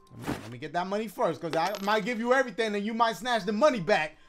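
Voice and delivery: normal talking voice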